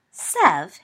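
loud, shocked exclamation; shocked hiss. A narrator's reading. Evans reproving her friend Snape, shocked. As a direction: cut him off, shocked, in one loud word. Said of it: "hissed Evans, shocked".

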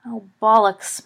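slow, loud groan of dismay; moaning. A narrator's reading. Lupin realising he missed the birthday, moaning. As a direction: moan it into a pillow. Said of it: "moaned Lupin into his pillow".

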